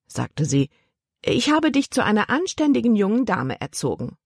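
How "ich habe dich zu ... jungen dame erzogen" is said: a higher, prim aunt voice; lightly stern reminder; aunt lecturing her niece, lightly; aunt's warning. A narrator's reading.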